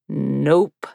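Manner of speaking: deep voice. Acted. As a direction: drop the voice for him, curt. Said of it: "His voice was deep".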